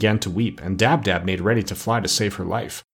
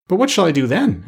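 higher-pitched protesting question; a touch of helpless pleading, pitched up; anxious pirate; 7 semitones higher than usual. A narrator's reading. Pirate asking the doctor how to live, mildly anxious.

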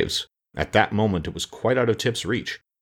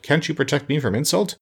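higher, mildly indignant growl; 7 semitones higher than usual. A narrator's reading. Offended sawhorse appeals to Tip, growling mildly. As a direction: complain as if insulted. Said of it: "he growled".

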